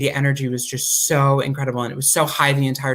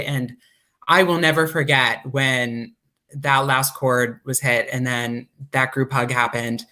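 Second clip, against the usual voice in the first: usual speaking voice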